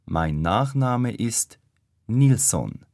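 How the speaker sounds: normal talking voice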